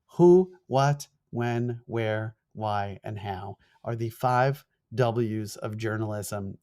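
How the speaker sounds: slowed down for international viewers; deliberately slow, clear listing. Shown as a demonstration. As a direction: spell it out slowly, word by word. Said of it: "I'll say it slower".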